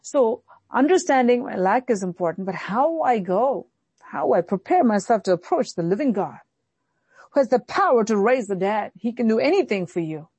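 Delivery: ordinary talk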